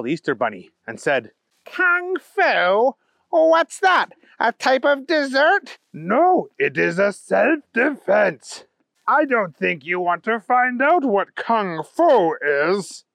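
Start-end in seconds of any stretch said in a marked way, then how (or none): 1.6-5.8 high-pitched, questioning bunny voice; naughty bunny Trent, puzzled. Acted. 5.9-13.1 higher, slow, measured bunny voice; Kyle, warning hero. Acted.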